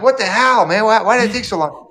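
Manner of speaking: loud, high-pitched exasperated demand; mock angry outburst. Husband to late partner bringing food, acted-out exasperation. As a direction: act out laying into someone who kept you waiting. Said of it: "I'm ready to go, like".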